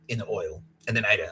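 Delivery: ordinary delivery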